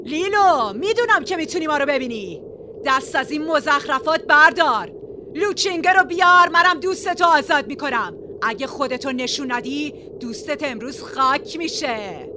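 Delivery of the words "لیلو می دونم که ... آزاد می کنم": high, loud shouted challenge. A narrator's reading. Leader shouting at his hidden enemy to come out, loud and high. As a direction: yell it across the field to the hidden enemy. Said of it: "با صدای بلند فریاد زد".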